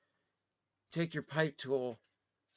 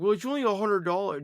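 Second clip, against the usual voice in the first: dismissive; 6 semitones higher, 6 dB louder than usual. Retold or imitated. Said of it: "some people are gonna be like".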